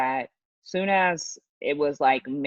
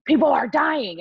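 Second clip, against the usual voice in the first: higher-pitched imitation of alarmist reporting; mock alarm; 8 semitones higher, 4 dB louder than usual. Media telling people about the virus, mock alarm. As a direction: raise the pitch like a panicky bulletin. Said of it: "It was just like".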